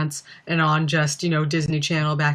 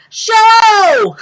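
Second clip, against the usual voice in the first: huge high, loud showbiz shout; 10 dB louder than usual. Acted. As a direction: belt it out as a hammed-up pop star. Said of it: "impression of [name] on S N L doing [name]".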